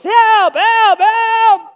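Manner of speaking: put-on high, loud childish yell; yelling for help. A bullied kid yelling for the recess lady, high and loud. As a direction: cry help like a trapped kid. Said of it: "I'm yelling".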